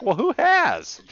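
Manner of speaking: high-pitched incredulous challenge; retold exasperation. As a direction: voice leaps up in mock outrage. Retelling exasperated challenge to engineer.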